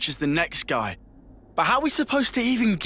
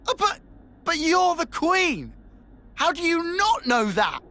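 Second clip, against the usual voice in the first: high-pitched, disbelieving exclamation; incredulous outburst; 10 semitones higher than usual. Acted. Teammate to the Queen who admits not knowing, incredulous. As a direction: blurt it out, pitch leaping up in disbelief. Shocked reaction to the Queen's admission.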